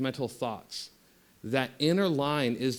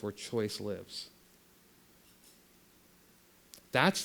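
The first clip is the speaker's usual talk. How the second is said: guided meditation; 3 semitones lower, 5 dB quieter than usual. Acted. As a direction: ease them inward, letting the voice sink and soften. Leads a meditation.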